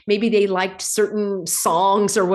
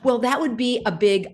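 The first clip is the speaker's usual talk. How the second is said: ordinary talk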